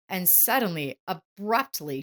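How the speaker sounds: ordinary delivery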